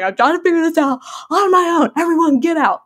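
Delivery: overwhelmed outburst. Retold or imitated. New mom crying with her baby tells parents to leave, mock frazzled. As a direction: act out a mildly panicked protest.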